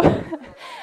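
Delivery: high breaking laugh on the verge of tears; half laugh, half sob; tearful laugh. Real, not acted. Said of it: "I'm gonna cry".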